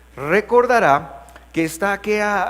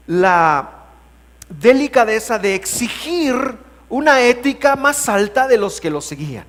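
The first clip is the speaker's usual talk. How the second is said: raised, drawn-out sermon delivery; emphatic preaching; 2 semitones higher, 5 dB louder than usual. Real, not acted. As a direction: preach it out with weighty pauses. Preaches on the passage.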